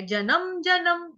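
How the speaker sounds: जन्म said as "ja-nam" with a full extra vowel; जन्म as colloquial "janam"; wrong pronunciation demo. Shown as a demonstration. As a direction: stretch जन्म wrongly into two syllables, ja-NAM. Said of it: "वो गलत है".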